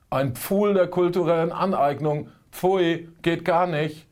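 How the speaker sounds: exaggerated moral indignation; mock outrage. Mock outrage about appropriation.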